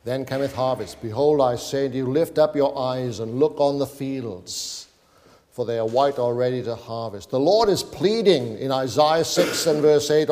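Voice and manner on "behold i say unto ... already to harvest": ordinary talk, nothing marked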